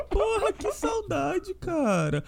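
high, soft, drawn-out pining; slow wistful wail, half joking; mock longing. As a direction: pine for your team, playfully overdone. Comic longing for his team.

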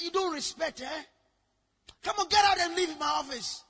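loud, high angry dismissal; angry outburst. Retold or imitated. Preacher throwing a woman out of his office, angry outburst. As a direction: order them out, re-enacting the anger. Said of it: "So I got angry".

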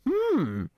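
high leering hum; a lecherous appreciative hum; approving hum. Retold or imitated. Cops ogling, approving hum.

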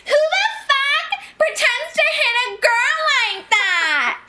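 high, slow shrill yell; shrieking mock yell at the grown man. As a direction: yell at the guy in disbelief. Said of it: "Maddie starts yelling at the guy".

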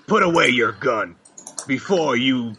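lightly deepened tough-guy threat; a hint of a macho warning; manliest voice. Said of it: "in the manliest voice possible".

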